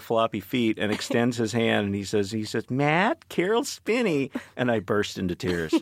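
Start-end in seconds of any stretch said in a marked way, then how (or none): 2.7-4.3 high, friendly puppet-character greeting; Big Bird. Retold or imitated. Puppeteer introduces himself to visiting writer, in Big Bird's voice. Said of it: "that Proustian Madeline of Big Bird's voice".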